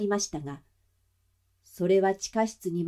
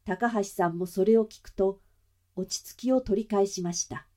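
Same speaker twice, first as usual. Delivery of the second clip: usual speaking voice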